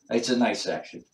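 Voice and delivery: usual speaking voice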